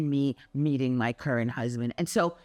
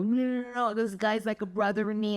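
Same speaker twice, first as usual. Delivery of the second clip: higher, eager insistence; excited protest; 7 semitones higher, faster than usual. Retold or imitated. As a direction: rush to correct the husband, lightly excited. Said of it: "I go".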